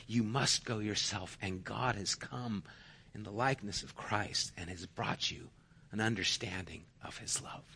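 soft, low, pause-filled appeal; hushed preaching. Real, not acted. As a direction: speak tenderly, nearly whispering at phrase ends. Preacher turns hushed and earnest.

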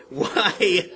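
loud, high drawn-out question; emphatic questioning. Acted. Preacher resumes the verse for congregation, emphatic question. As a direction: ring the question out over the room.

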